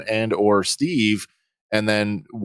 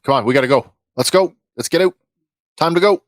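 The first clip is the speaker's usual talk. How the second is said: raised, higher urgent calls; yelling; 6 semitones higher, 4 dB louder, much faster than usual. Acted. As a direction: yell at a friend to hurry out. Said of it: "I start yelling at".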